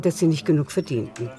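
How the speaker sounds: ordinary talk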